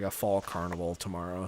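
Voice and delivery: ordinary talk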